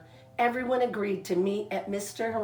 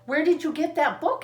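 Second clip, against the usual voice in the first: eager question; 8 semitones higher, 3 dB louder than usual. Acted. Franklin asks Mister Mole eagerly.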